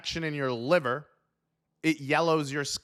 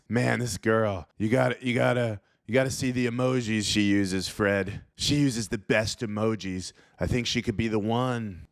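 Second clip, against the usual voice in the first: low, dopey voice of a smitten online husband; lovestruck guy; 6 semitones lower than usual. Pretend. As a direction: gush like a guy in love with emojis. Imagined husband gushing to friend.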